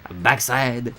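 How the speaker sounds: mock-French, word by word with pauses; bad French accent. Player rereading the butt synonyms, bad French accent. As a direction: list them in a hammy French accent. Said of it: "my bad French accent".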